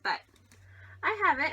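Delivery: usual speaking voice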